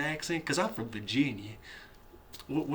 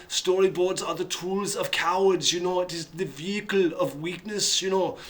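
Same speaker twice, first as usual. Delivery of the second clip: higher, deliberate, thick German-accented pronouncement; Werner Herzog; 3 semitones higher than usual. Shown as a demonstration. Film director scorning storyboards as tools of cowards, heavy Bavarian accent. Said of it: "Werner Herzog, he has the strong accent".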